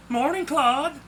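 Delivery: bright, high, lifted cheery hello; cheery greeting. A narrator's reading. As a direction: call out a cheerful morning greeting. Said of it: "a cheery voice said".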